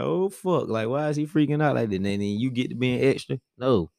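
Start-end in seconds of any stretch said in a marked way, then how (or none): 0.0-2.0 raised-pitch faked alarm, a bit fast; lightly voiced panicked wife; mock alarm. Husband imitating his wife alarmed on the operating table, mock alarm. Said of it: "have you on the table like".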